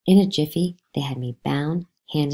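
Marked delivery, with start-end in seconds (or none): none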